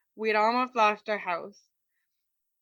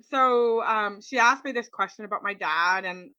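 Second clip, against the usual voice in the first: usual speaking voice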